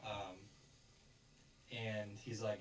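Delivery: usual speaking voice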